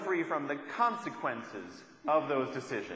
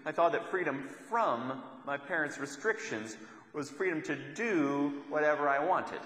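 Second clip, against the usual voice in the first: usual speaking voice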